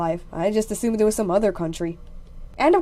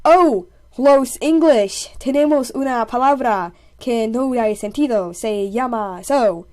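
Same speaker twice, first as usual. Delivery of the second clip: put-on slow, laboured Spanish with an English speaker's accent; English-speaking guy's Spanish. English-speaking guy pitching "so" to the Spanish, slow clumsy Spanish. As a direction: play the lone English speaker struggling through Spanish, drawn out. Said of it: "the only English-speaking guy".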